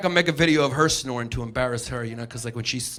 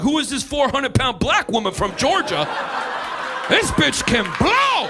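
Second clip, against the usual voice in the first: mock amazed listener; 7 semitones higher than usual. Listener first hearing [name].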